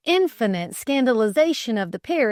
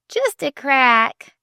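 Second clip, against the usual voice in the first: conceding; 6 semitones higher, 7 dB louder, much slower than usual. Acted. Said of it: "conceded Flame".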